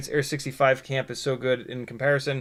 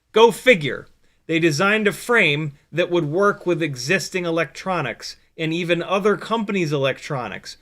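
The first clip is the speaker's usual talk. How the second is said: higher, louder mock amazement; sarcastic; 5 semitones higher, 4 dB louder than usual. Real, not acted. Streamer ribbing other companies over a well-designed frame, sarcastic. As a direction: lay the sarcasm on, pitched up and emphatic. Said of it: "Was that sarcasm?".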